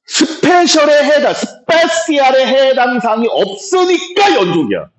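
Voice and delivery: high, drawn-out recitation; demonstrated reading aloud. Shown as a demonstration. Teacher models the answer for students, high sing-song recitation. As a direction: chant it out high, showing students how to read. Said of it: "이렇게 읽어야 돼".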